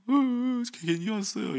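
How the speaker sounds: high, whimpering mock sob; a hammed-up teary child's complaint; imitating a crying kid. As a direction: whine it like a worn-out child about to cry. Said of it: "어떤 애들 막 울어요".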